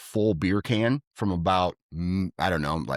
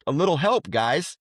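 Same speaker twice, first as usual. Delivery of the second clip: sarcastic plea; 7 semitones higher than usual. Retold or imitated. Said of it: "And I'm like".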